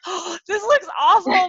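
high, loud half-laughing wail of dismay; a mock cry of horror at a bad photo; hammed-up shriek of horror; horrified cry. Reacting to bad photo of self.

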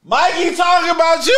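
high, loud denial; loud protest. Real, not acted. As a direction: shout it in protest.

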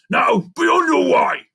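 loud, high, slow animal command; dismissive shout. A narrator's reading. Rhinoceros sending the monkey away, dismissive shout.

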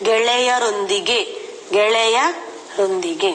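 ಗೆಳೆಯರೊಂದಿಗೆ stretched out slowly, every syllable apart. Shown as a demonstration. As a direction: draw the joined word out for spelling. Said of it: "ಒಂದೇ ಪದ ಬರೆಯುವಾಗ". (ತುಂಬಾ ನಿಧಾನವಾಗಿ ಅಕ್ಷರ ಬಿಡಿಸಿ)

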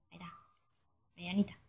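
casi susurrando, para sí; susurro. Real, not acted. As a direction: murmurarlo en voz muy baja. Susurra la respuesta bajito.

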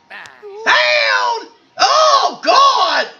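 high-pitched yell of shock; pained outcry. Acted. Character reacting to being slapped, high pained shout.

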